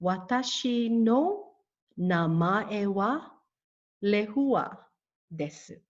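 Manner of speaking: slow model sentence with gaps between phrases; broken down. Shown as a demonstration. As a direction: say it slowly, piece by piece, for learners. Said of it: "Let me break this down for you".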